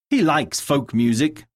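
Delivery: ordinary talk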